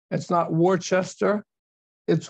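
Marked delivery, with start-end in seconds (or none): 0.5-1.4 Worcester said as "WAR-chess-ter", slow and rising; spelling pronunciation. Shown as a demonstration. Speaker showing newcomers how not to say the city, spelling pronunciation. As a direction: say Worcester as spelt, with "chester" and r's. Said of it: "how to pronounce the name".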